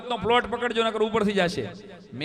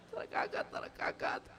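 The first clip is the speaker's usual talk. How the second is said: mock wailing; 11 dB quieter than usual. Widow wailing for her dead husband to the boy, hushed mock lament. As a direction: stretch the kaka into a hushed lament. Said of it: "રોતા રોતા કીધા".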